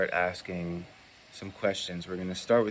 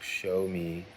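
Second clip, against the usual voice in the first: slow, deliberate "Show me yes" with a rising yes; calm slow test phrase; slow, drawn-out ask; much slower than usual. Shown as a demonstration. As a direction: ask it slowly, letting yes lift. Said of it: "You're going to ask".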